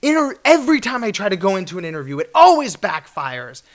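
loud, frustrated venting to a friend; exasperated pleading. Retold or imitated. Venting to friend outside the store, loud frustration. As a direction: complain loudly that interviews fail. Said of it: "I'm like".

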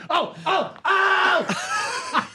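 high comic scream, rising; repeated high-pitched yelps building up; Kinison scream. Retold or imitated. Impressionist mimics a screaming comic, high shrieks. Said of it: "Kinison calls me and goes".

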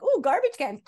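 put-on high, loud thrilled toddler voice; excited toddler. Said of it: "He's like".

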